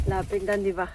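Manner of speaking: ordinary talk, nothing marked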